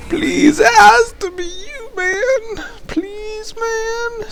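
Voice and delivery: high, whiny mock pleading; whiny begging. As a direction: beg like a desperate fan, whimpering. Corey begging in retold chat.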